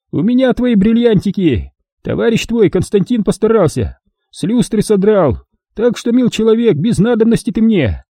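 put-on high, gloating old man voice; a cruel old miner mocking his victim; old prospector. A narrator's reading.

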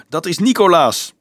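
shouting up. A narrator's reading. Dolf to officer above, shouting up. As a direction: shout it up to the battlements. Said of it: "schreeuwde Dolf naar omhoog".

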